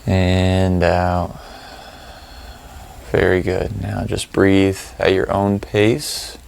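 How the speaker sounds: calm, spacious delivery, letting each phrase settle; guided meditation. Real, not acted. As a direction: lead them through the breathing, unhurried and even.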